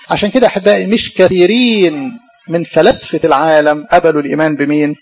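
usual speaking voice

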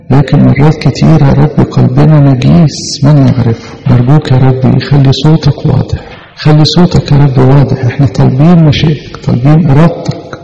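normal talking voice

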